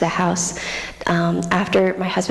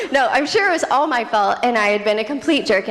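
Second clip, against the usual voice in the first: normal talking voice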